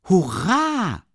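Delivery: high, loud cry of joy; cheering shout. A narrator's reading. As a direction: burst out with a happy hurra. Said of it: "rief Rasumichin". (begeisterter lauter Jubelruf)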